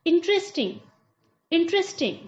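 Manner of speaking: interesting said as in-tres-ting; correct interesting. Shown as a demonstration. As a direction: model interesting in three syllables. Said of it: "सही तरीका इसका है बोलने का".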